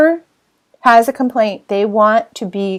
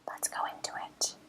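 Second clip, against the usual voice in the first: whisper; 24 dB quieter than usual. Real, not acted. Whispered aside before first step.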